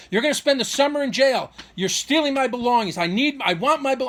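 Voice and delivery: high-pitched mock ranting; retold scream. Father threatening son with jail, retold scream. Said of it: "He's screaming".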